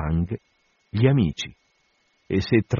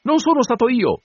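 high, louder shrill denial; the steward squeals his innocence to Stubb, much higher; shrieked; 4 dB louder than usual. A narrator's reading. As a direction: squeal it in panic. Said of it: "strillò Farinata".

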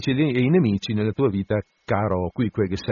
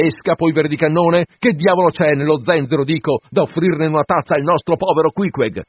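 ranting; 5 semitones higher, 5 dB louder than usual. A narrator's reading. Stubb rants at the steward.

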